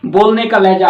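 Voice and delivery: usual speaking voice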